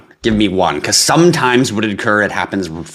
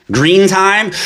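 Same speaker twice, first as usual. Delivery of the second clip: calling out name; 9 semitones higher, 4 dB louder than usual. Real, not acted. Calling out viewer names.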